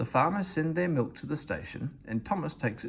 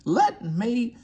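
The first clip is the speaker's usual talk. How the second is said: outraged; 12 semitones higher, 7 dB louder than usual. Acted. Daisy cut off angrily.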